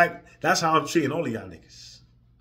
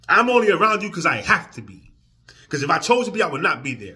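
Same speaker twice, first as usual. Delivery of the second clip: higher and louder, furious; angry rant; 5 semitones higher, 8 dB louder than usual. Real, not acted. Ranting at viewers who ask about his family, furious. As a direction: let the rage boil over. Rant against bosses and fake friends.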